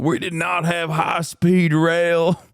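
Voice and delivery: slow, mock thick American accent. Mocking a grifter in a thick American accent. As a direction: drag it out in a hammed-up American drawl. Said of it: "a thick American accent".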